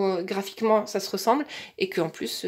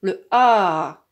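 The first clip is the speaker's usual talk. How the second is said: slow, deliberate teacher's request; 8 dB louder, much slower than usual. Shown as a demonstration. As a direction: draw every word out for a small child. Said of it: "mais ça doit être très, vraiment très, très lent". (consigne très lente et posée)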